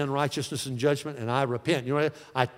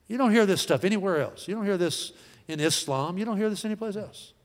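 normal talking voice

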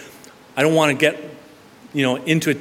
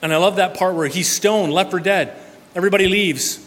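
normal talking voice

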